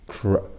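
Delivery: "Cr-" as a short k-r burst; consonant blend. Shown as a demonstration. Teacher leading into crunchy, isolated "Cr-" blend. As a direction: sound out "Cr-" before the word. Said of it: "includes the consonant blend".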